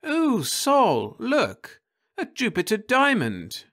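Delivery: put-on high, delighted young woman's voice; Florence's voice. A narrator's reading. Wife spotting a diamond on the carpet, high and delighted. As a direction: squeal it out as thrilled Florence.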